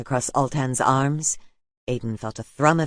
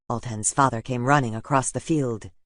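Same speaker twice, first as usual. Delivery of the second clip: usual speaking voice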